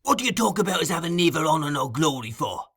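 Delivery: angry exclamation. Acted. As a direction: scold him sharply.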